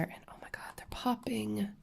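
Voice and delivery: excited whisper, barely voiced; hushed thrilled whisper. Real, not acted. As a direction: breathe out your delight at the sparkle. Whispered delight at colors.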